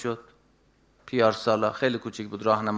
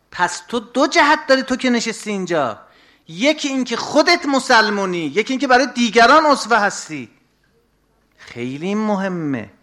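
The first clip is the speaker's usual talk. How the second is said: higher, raised sermon voice; preaching; 8 semitones higher, 5 dB louder than usual. Acted. Preacher reminding listeners they are role models, raised and urging. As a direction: press the point on the listener.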